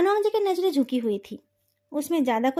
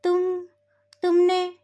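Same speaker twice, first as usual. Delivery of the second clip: halting, higher, words held and broken off; faltering speech; 4 semitones higher, slower than usual. A narrator's reading. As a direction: stumble in shock, pausing after the first word. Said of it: "उसकी जुबान लड़खड़ा गई". (लड़खड़ाती ज़ुबान में, अटकते हुए)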